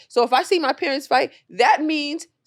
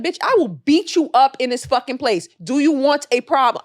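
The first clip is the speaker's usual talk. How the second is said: retold threat; 4 semitones higher, 6 dB louder than usual. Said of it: "I said".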